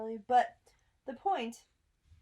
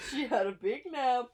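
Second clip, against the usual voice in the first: movie trailer voice; 4 semitones higher, 5 dB louder than usual. Pretend. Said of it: "That sounds like a movie trailer".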